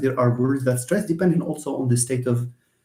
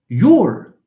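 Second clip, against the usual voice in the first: "your" drawn out, loud and slow; "your" leaned on hard; stress on YOUR; 4 semitones higher, 8 dB louder than usual. Shown as a demonstration. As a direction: punch the word "your" and stretch it. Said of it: "Now, if I stress".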